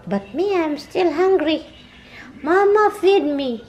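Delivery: high, pleading voice of a hungry puppy; high whiny pet voice; whiny dog voice. Pretend. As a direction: beg Mama for food like the dog.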